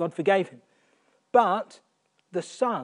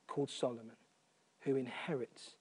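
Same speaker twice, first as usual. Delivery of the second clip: ordinary talk, nothing marked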